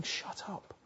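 low, voiceless shushing; hushing bystanders. Acted. Crowd silencing a blind beggar, hushed. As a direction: hiss it under the breath. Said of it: "those around him were saying".

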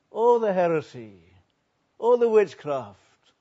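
loud, hammered list, each phrase falling; emphatic, drawn-out denunciation; impassioned preaching. Real, not acted. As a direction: drive home each item from the pulpit. Preacher piles up list of sins.

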